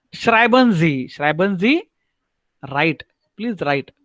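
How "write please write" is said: ordinary delivery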